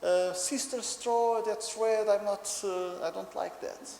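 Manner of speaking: high, loud whiny child imitation; upset little boy. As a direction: protest to daddy like an upset three-year-old. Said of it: "And he says".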